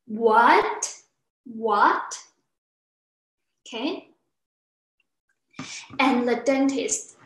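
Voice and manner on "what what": "What" swooping from the bottom to high; rising tone. Shown as a demonstration. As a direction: stretch "What" into an upward question. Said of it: "to the high pitch".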